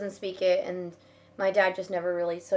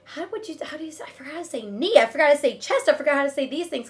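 higher mock panic; 6 semitones higher, 4 dB louder than usual. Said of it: "I was just like".

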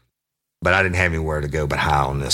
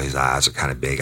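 ordinary talk

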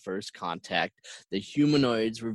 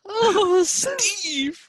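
very high, loud anguished cry; dramatic wail; 6 dB louder than usual. Acted. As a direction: wail the cursed name. Forbidden name said again.

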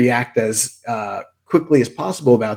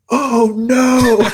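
high startled shriek, louder; 12 semitones higher, 6 dB louder than usual. Retold or imitated. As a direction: yelp it out in shock. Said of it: "and I, like, shrieked".

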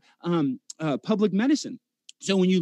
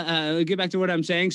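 ordinary delivery

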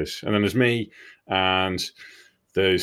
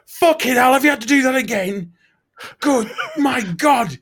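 high, loud, near-crying outrage; disgusted ranting; 6 dB louder than usual. Retold or imitated. Fingers shouting across the yard at Bob again, loud wailing rant. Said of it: "he's proper ranting about it".

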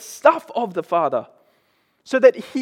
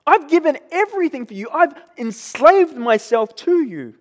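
mock yell; 6 semitones higher than usual. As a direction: pitch raised, indignant mock yell. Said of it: "he's yelling at his father".

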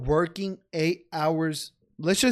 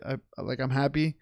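ordinary talk

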